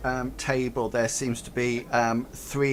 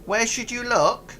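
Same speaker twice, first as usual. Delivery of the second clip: higher goblin voice; literal goblin; 7 semitones higher than usual. Acted. Goblin giving the players a literal answer. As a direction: answer with simple earnestness.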